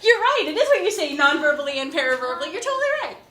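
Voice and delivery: loud, high, laughing through the words; delighted, laughing exclamation; laughing agreement. Real, not acted. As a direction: burst out laughing and keep talking. Laughs at student's comical guess.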